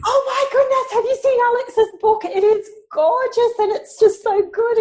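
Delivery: high-pitched gushing rave, acting out a friend; excited. Friend texting a rave about Alex's book, excited. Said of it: "a very excited text message".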